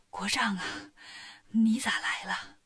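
weak, breathless whisper of a sick king; barely able to get the words out; ailing king straining to speak; feeble king. A narrator's reading. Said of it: "说话都没力气".

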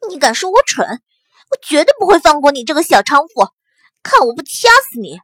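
loud, raging threat; furious shout. Acted. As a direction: scream it at her, out of control. Insulted woman threatens in fury.

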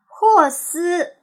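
high, loud class reply; loud chorus answer. Acted. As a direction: call out the name together. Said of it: "大伙儿大声答". (全班大声齐答)

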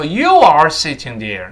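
"you are" said in full, both words stressed; overstressed YOU ARE. Shown as a demonstration. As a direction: spell out "you are" instead of gliding over it. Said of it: "在这儿不能说".

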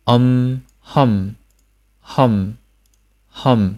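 "hum" ending on a held, hummed m; teacher's careful model of "um" and "hum" with m sustained; closed-lip nasal ending. Shown as a demonstration. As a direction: shut both lips to finish each "hum". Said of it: "闭合双唇的鼻音".

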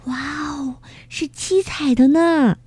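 high, delighted wow at a rainbow; little bugs gasping in wonder; amazed exclamation. Acted. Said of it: "几个小家伙一边惊叫着".

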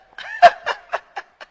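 high, loud burst of laughter; gleeful laugh. Acted. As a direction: cackle with delight at a windfall. 掌柜为发财窃喜大笑. (得意地高声大笑)

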